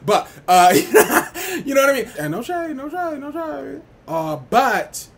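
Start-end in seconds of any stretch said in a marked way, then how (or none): 0.8-1.6 high burst of laughter; loud cackle. Real, not acted. 2.2-3.8 high, sing-song teasing; sassy no shade. Real, not acted.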